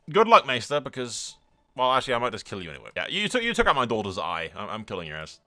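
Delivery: usual speaking voice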